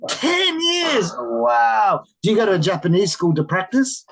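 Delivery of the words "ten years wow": very high astonished squeal, then a drawn-out wow; big, high-pitched teacherly amazement; amazed. Real, not acted. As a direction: react as if utterly astounded. Teacher amazed at second student.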